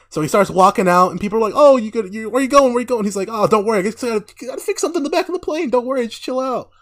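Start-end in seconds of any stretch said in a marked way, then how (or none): 1.5-3.0 high, urgent questioning; mock alarm, pitched up; imitating alarmed passengers. Voicing alarmed passengers as he leaves. 3.3-6.7 high-pitched, hurried brush-off; imitating a breezy, false placation; mock reassurance. Voicing Homelander's false reassurance.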